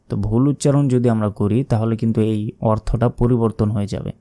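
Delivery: ordinary talk, nothing marked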